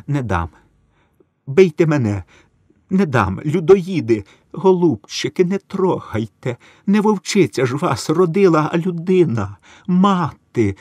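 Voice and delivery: weeping plea. A narrator's reading. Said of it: "заплакала Стояниха".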